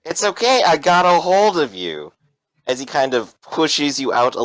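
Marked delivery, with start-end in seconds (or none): 0.0-2.1 high-pitched, insistent reassurance; acted reassurance. Game master as Thomas holding you out the window, reassuring. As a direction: reassure them in a raised character voice.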